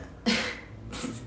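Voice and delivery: quick breathy chuckle; a short airy laugh; soft laugh. Real, not acted. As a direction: laugh quietly to yourself. Laughs while telling story.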